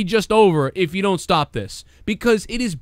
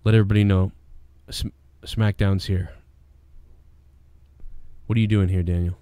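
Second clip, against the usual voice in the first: low, measured radio call; composed authority; 11 semitones lower than usual. Shown as a demonstration. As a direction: demonstrate a calm, controlled alert. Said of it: "It would go something like that".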